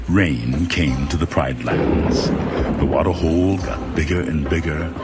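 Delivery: plain reading voice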